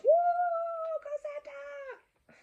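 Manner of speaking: very high, slow, hammed-up cheer; a storyteller cheering for Santa with the kids. Shown as a demonstration. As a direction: lead the crowd in a big elf cheer. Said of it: "when you cheer with the elves".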